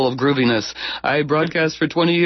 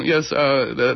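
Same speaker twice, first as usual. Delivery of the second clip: ordinary talk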